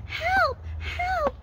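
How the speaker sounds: very high little pig voice; high-pitched puppet in mock distress; pig crying for help. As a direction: squeal a pretend plea for help. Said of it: "The pig said".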